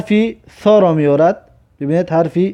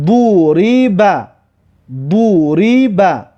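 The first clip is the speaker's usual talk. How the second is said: slow, joined syllables with held vowels; drawn-out model reading; much slower than usual. Shown as a demonstration. Teacher models a word for learners, slow and drawn out. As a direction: stretch each vowel of "دوریبه".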